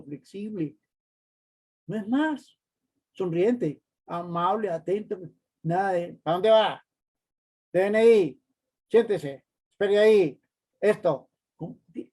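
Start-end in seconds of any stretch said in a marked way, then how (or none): 6.2-11.3 loud, barking jail-guard orders; prison-guard authority. Shown as a demonstration. Gruff doorman ordering visitor around, loud and harsh. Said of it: "deje ese tono de autoridad de cárcel".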